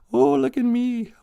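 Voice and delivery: very high mock show-off voice; high silly look at me squeal. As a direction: preen like you want everyone watching. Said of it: "I say that a lot".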